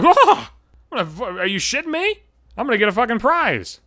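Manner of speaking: high, loud whoa, then a stunned rush; thrilled to spot a prize; excited disbelief. Retold or imitated. Runner spotting his name on the list, high excited whoop.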